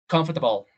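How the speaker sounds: comfortable as com-fer-ta-ble; old-school comfortable. Shown as a demonstration. Teacher imitating his clarinet teacher Robert's comfortable. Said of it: "de la manera antigua".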